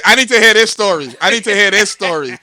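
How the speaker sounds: loud, high, eager insistence; practically shouting to hear the story; excited demand. Real, not acted. Begging to hear the drama.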